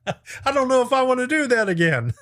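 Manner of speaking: laughing it off, pitch jumping up. Real, not acted. Laughing to viewers about the power-surge music. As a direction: chuckle through the line about the power surge.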